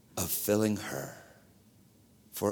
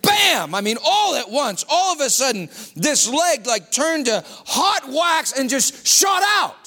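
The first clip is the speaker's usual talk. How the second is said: excited telling; 4 semitones higher than usual. Real, not acted. Preacher telling his own healing to the church. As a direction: relive the shock.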